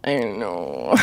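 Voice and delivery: low creaky fry, trailing off; vocal fry. Real, not acted. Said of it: "and then we got to vocal fry".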